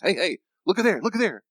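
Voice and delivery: high, excited heads-up; urgent alert. Acted. Brain pointing out a possible threat, urgent alert. Voices an alert, calling attention.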